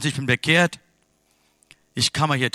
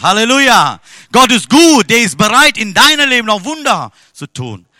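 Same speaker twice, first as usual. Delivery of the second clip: louder, higher proclaiming praise; full-force preaching; 9 semitones higher, 13 dB louder than usual. Acted. Preacher proclaims God's miracles to the church, full force. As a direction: ring it out to the congregation.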